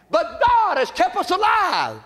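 high ringing shout; preaching shout. Real, not acted. Preacher shouting God's faithfulness to the church. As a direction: shout it out to the congregation.

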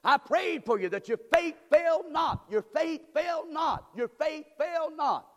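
high, loud, slow preacher's cry; full-force preaching. Acted. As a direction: call it out slow and loud to the congregation.

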